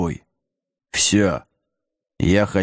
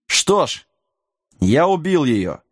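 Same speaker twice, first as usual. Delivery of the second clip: proudly owning up, a little higher; proud reply; 4 semitones higher than usual. A narrator's reading. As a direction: answer with a touch of defiant pride. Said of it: "гордо откликнулся Джек". (гордый ответ)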